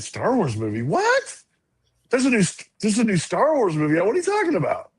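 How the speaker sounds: put-on high-pitched, incredulous kid reaction; acted-out amazement at surprising news; retold astonishment. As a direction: replay disbelief, voice leaping up on the questions. Retells child's astonishment at news.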